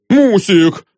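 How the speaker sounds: puffed, effortful cry; breathing heavily. A narrator's reading. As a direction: call out winded from a struggle. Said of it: "тяжело дыша".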